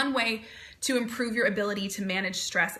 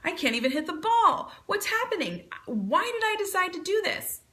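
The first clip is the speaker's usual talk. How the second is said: rising to a much higher pitch in mock panic; panicky self-talk; 9 semitones higher than usual. Athlete missing the ball, voicing mock panic much higher. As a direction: spiral up into frustrated worry. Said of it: "I pick up a racket and".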